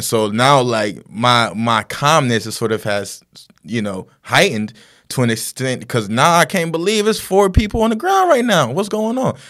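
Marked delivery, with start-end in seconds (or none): 5.9-9.4 high, loud rush of disbelief; acted panic, voice pitched way up; retold alarm. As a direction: relive losing your calm at a horror. Reliving his rising alarm at crash.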